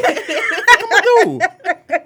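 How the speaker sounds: high, laughing, what-can-I-do; laughing helplessness. Real, not acted. Laughing about a huge cousin, high and helpless. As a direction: throw up your hands while laughing.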